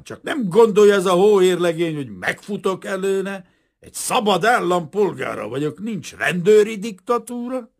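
high, loud, indignant tirade; furious protest. A narrator's reading. Kazimír defying the police as a free citizen, furious. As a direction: declaim your rights in outrage.

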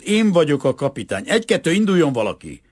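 commanding. A narrator's reading. Boy captain orders someone to start, commanding. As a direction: hurry them along with authority.

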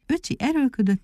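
plain reading voice

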